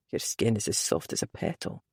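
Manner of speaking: soft low whisper. Acted. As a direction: breathe it in his ear. Intimate whisper to lover.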